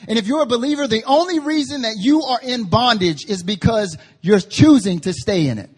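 high, insistent sermon voice; urgent pulpit challenge; forceful preaching. Real, not acted. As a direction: press the point hard on the congregation.

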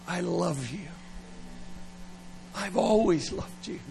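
soft, hushed mock-tender I love you; tender confession. A young lover confessing to a date, soft and tender. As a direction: hold the words out gently like a secret. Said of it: "and you go".

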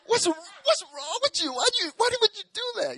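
put-on very high, loud whiny child; little kid voice. Little boy scolding his mom, high whiny outrage. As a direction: whine like a disgusted little boy.

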